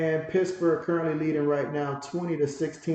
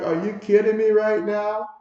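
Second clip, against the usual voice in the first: mock outraged, higher and louder, rising at the end; louder disbelief; 6 semitones higher, 6 dB louder than usual. Lewis can't believe the call, louder mock outrage. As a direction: push the disbelief up, hammed-up exasperation. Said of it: "He's like".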